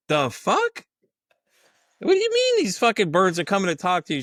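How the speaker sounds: high, loud disbelief; baffled at the birds; incredulous. Retold or imitated. He reacts to her bird story, high and incredulous.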